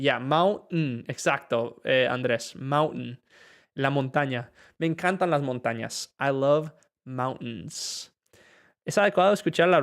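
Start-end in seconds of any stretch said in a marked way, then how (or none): 0.0-1.0 "mountain" said slowly, with a glottal stop for the t; slow teacher model of "mountain"; slow model word. Shown as a demonstration. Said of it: "pronunciación para mountain". 6.2-8.1 "mountains" said very slowly, with a glottal stop for the t; slow teaching sentence; slow model sentence. Shown as a demonstration. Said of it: "pronunciación para mountain".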